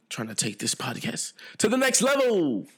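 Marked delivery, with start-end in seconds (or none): 1.6-2.7 hype announcer yell. Pretend. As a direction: belt it out like a big hype slogan. Hyping up his own podcast.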